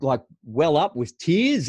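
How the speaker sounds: usual speaking voice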